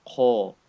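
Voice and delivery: Kha said heavy with a full mouth. Shown as a demonstration.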